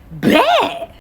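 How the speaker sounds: loud, high excited bet; eager bet. Real, not acted. Accepting the trainer's challenge, loud excited bet.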